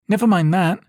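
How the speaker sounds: put-on high, loud girl's voice cutting in; girl chiming in. A narrator's reading. Isara interrupting her brother, high and loud. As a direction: cut in eagerly as Isara. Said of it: "Isara chimes in".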